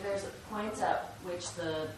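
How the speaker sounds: ordinary talk, nothing marked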